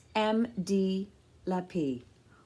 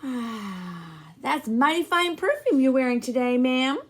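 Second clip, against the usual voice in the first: a hint of a jovial man, louder; lightly voiced friendly old man; 5 semitones higher, 8 dB louder than usual. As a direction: chuckle along with the grandmother. Blind man complimenting Nana.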